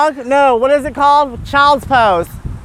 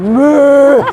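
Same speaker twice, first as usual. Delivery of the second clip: mock cow moo; 5 dB louder than usual.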